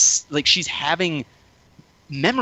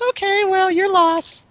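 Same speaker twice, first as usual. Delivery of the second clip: very high mock feminine singsong; spurned date's brush-off; 4 dB louder, slower than usual. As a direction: imitate her airy parting shot way up high. Imitates spurned date.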